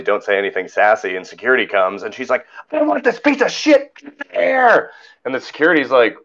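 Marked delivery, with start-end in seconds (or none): 2.7-4.9 high, slow, drawn-out screech; mock furious demand. Angry lady complains to security about him, mock screech. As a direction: imitate a woman shrieking for someone's removal. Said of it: "she's losing it".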